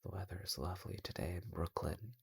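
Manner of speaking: soft, barely-there cold murmur; very quiet voice. Acted. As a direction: say it very softly, coldly polite. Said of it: "He said in a very quiet voice".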